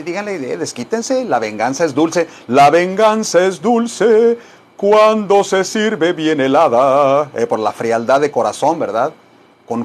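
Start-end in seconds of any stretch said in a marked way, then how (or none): 2.5-7.3 slow, dramatic soap opera delivery; telenovela villain. Acted. Teacher hamming up a villain's line for students. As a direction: savour each word like a telenovela villain.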